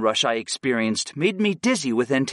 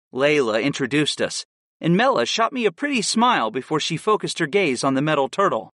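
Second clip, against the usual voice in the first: ordinary delivery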